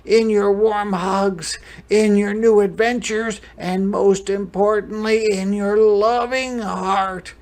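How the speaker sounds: big heartfelt storytime voice; loving grandfather. Acted. Abuelo's heartfelt farewell speech in story.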